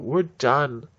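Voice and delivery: loud and final, fed up; mock shout. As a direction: slam it down like you're quitting. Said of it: "throw up their hands and go".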